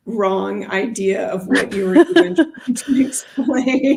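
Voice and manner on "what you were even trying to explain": words coming out through a chuckle; laughing. Real, not acted. Teacher laughing to a colleague about confused students. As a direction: laugh as you finish the thought.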